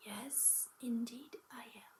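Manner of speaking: put-on hushed old woman voice; old woman whisper. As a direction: answer in a frail whisper. Old woman answers softly.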